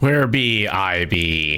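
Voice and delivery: put-on pirate accent. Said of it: "Jordan had fun with that accent".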